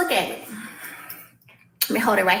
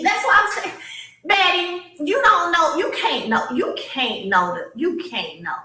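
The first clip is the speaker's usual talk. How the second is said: ordinary talk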